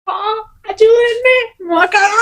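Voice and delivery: high, slow, laughing shout for help; retold shout. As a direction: yell it as in the delivery room, laughing through it. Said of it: "yo gritaba". (grito de parto recordado entre risas, agudo y lento)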